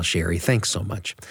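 ordinary talk, nothing marked

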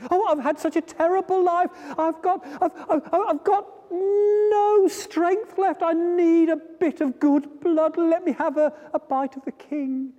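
flea voice, high and desperate, near tears; high pleading flea. Acted. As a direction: beg for food in a high voice.

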